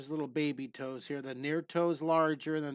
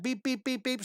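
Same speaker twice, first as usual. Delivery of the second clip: higher, sing-song beeping; playful beeps; 8 semitones higher than usual. Pretend. Teacher amusing viewers while drawing, sing-song beeps. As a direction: beep like a little machine.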